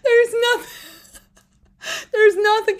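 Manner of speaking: high and loud through tears of laughter; can barely get it out for laughing; cry-laughing. Real, not acted. Laughing hard about the clip.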